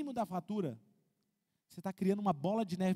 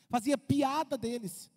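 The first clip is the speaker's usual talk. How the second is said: ordinary delivery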